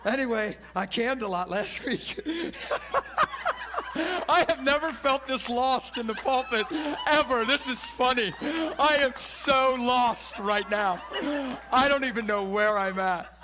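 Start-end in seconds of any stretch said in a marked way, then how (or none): 2.2-4.2 high, helpless burst of laughter; real laugh. Real, not acted. Laughing at losing his place. 4.3-13.3 real amused exasperation, loud and laughing; higher, louder, cracking up between phrases; laughing at himself. Real, not acted. Cracking up at being lost mid-sermon.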